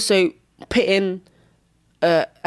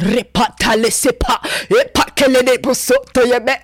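loud, high ecstatic tongues; speaking in tongues; 6 semitones higher, 6 dB louder than usual. Retold or imitated. Praying in tongues at three thirty, loud and high. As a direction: blast out prayer in tongues. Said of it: "I was blasting in tongues".

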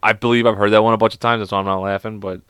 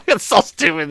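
higher-pitched, laughing through the words; 6 semitones higher than usual. Real, not acted. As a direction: talk while cracking up. Cracks up at own joke.

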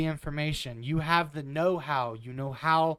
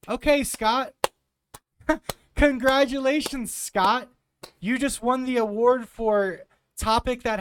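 loud, sarcastic congratulations with a laugh; mock-praise him, laughing and loud; sneering congratulations; 6 semitones higher, 4 dB louder than usual. Real, not acted. Sarcastic retort to senator.

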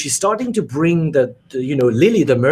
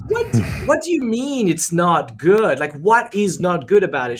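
defensive disbelief; 6 semitones higher than usual. Retold or imitated. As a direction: push back in mock wounded surprise. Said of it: "and I'm like".

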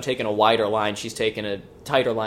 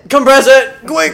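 frantic high shout, much louder; urgent yell; 12 semitones higher, 14 dB louder than usual. Pretend. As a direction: yell it in mock panic. Panicked urgent shout about compressing.

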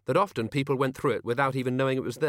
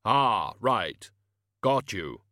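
very slow, booming, grave voice; Death's voice; 4 dB louder, much slower than usual. Acted. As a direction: drag each word out like a tomb.

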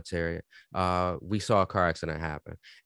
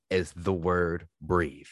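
slow emphatic; 4 semitones lower, much slower than usual. Real, not acted. Slow emphatic key word.

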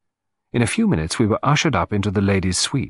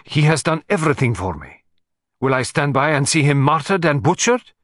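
put-on dramatic Russian-accented countess, higher pitched; impassioned Russian countess; 5 semitones higher than usual. A narrator's reading. Countess defending the man who helped her, impassioned. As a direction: plead like an indignant Russian countess.

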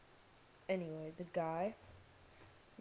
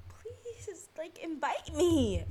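high, slow whiny plea; drawn-out pleading whine; begging whine; 9 semitones higher, 7 dB louder, much slower than usual. Retold or imitated. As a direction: beg like a needy kid. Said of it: "I'm like".

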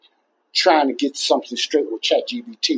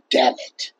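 ordinary talk

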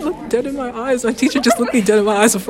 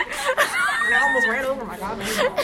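slow, higher mock-Southern voice; Southern accent; 6 semitones higher, 3 dB quieter, slower than usual. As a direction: ham up a drawn-out Southern accent. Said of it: "we chose Southern accents".

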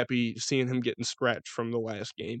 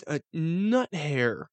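drawn-out nut hair; much slower than usual. Acted. Stretching out a tiny amount.

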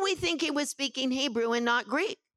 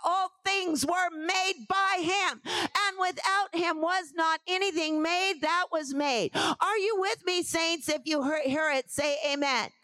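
preaching; 4 semitones higher than usual. Acted. Preaching climax.

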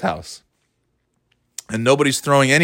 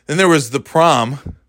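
ordinary delivery

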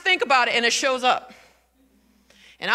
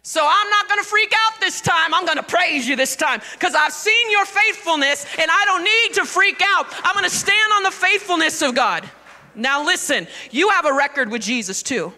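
high, loud, driving declaration; full-force preaching; 6 semitones higher, 6 dB louder than usual. Real, not acted. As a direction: preach it out with raised pitch and volume.

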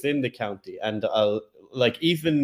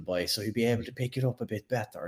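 softer, thick Irish brogue; thickened Irish accent; 7 dB quieter than usual. Shown as a demonstration. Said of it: "I'll even thicken up the accent".

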